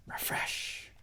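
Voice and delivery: hushed, half-whispered repetition; dazed doom-scrolling. Acted. Acting out refreshing election news sites, dazed whispering.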